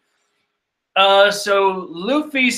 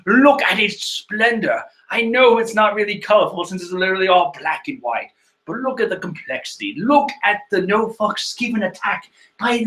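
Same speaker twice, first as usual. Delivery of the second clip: mock grand voice; 3 semitones higher than usual. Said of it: "I don't know what voice I'm giving it".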